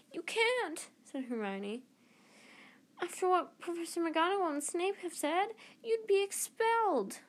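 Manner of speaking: put-on high, pleading girl's voice; alarmed girl. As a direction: plead with him, pitched high and worried. Hermione pleads with Harry.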